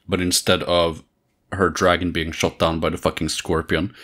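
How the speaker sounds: ordinary talk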